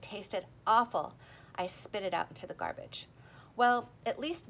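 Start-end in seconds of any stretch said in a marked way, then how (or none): none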